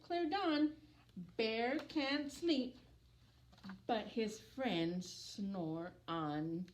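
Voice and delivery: lilting storybook voice for little ones; gentle singsong picture-book narration; reading to children. A narrator's reading. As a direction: read it like bedtime story time. Reads picture book aloud.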